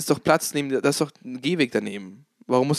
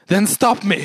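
cool defiant quote; 4 semitones higher, 4 dB louder, slower than usual. Retold or imitated. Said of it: "und er so".

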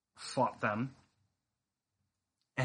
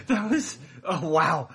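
laughing; 5 semitones higher, 6 dB louder than usual. Real, not acted. Player reacts, laughing hard.

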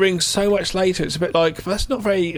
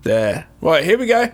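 loud, cocky mock bravado; cocky cop; 3 dB louder than usual. Cop refusing a coffee lid, loud cocky bravado. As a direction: play the overconfident cop brushing off help. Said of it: "and he's like that".

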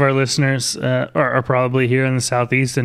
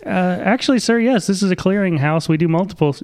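higher, lightly voiced operator; mock salesman; 7 semitones higher than usual. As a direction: play the flustered phone rep. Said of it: "Just be like".

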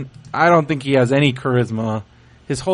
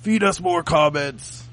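wrestling promo voice, laughing; Ryback voice. Retold or imitated. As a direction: wrestler catchphrase delivery. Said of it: "But my Ryback is more like".

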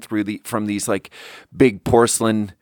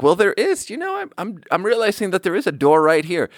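high-pitched, would-be casual remark; offhand act pitched too high; feigned casual; 6 semitones higher than usual. Retold or imitated. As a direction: play it casual in a raised, awkward voice. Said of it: "casually trying to be like".

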